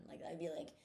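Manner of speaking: normal talking voice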